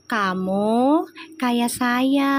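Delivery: very slow, gentle keraton lady voice; slow refined speech. Retold or imitated. Spirit twin answering her, slow refined court speech. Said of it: "Ngomongnya pelan banget".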